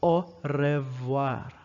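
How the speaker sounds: "au revoir" with a trilled Spanish R; slow, wrong-way "au revoir" with Spanish R. Shown as a demonstration. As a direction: roll the R in "revoir" as in Spanish, slowly. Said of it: "That's Spanish R".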